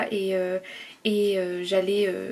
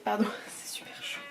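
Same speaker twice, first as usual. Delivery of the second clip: pained whisper; 10 dB quieter than usual. Real, not acted. Burnt by hot chocolate.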